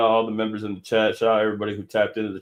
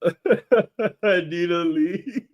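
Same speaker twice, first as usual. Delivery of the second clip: laughing; 6 semitones higher, much slower than usual. Real, not acted. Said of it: "I'm trying not to laugh".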